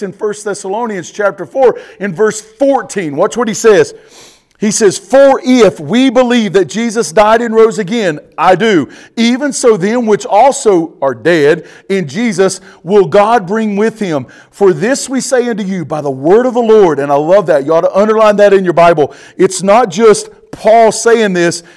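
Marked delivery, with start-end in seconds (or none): 5.0-17.0 loud, ringing preacher's scripture read; booming pulpit reading. Acted. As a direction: proclaim the verse to the congregation. Preacher reads scripture aloud.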